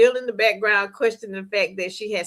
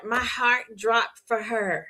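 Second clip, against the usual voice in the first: ordinary talk, nothing marked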